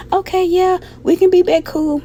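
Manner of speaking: high, sweet eager yes; high, giddy agreement; meek giddy agreement. Retold or imitated. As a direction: act like a lovestruck pushover. Meekly agreeing to take him back.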